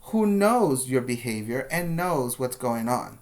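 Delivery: usual speaking voice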